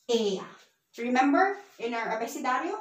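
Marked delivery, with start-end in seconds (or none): 0.1-0.5 "ella" said with a Y sound for the LL; double L as Y. Shown as a demonstration. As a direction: pronounce "ella" as EH-ya, the Spanish way. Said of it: "double L is Y".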